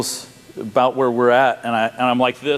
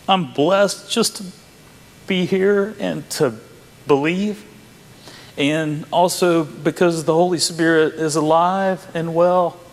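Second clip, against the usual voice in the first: slow, high, choked-up testimony; real emotion tightening the voice; tearfully grateful; 5 semitones higher, slower than usual. Real, not acted. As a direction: squeeze out each phrase through grateful tears. Tearful close of personal testimony.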